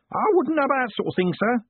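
put-on fast, high embarrassed old man; flustered old Groat. A narrator's reading. Old Groat embarrassed before his boss, fast and high. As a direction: blurt it out, flustered. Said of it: "said Groat, blushing".

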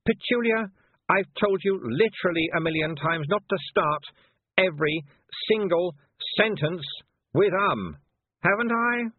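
put-on high, hectoring head-girl voice; imperious, high-pitched scolding; bossy young witch, exasperated. A narrator's reading.

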